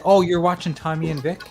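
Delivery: normal talking voice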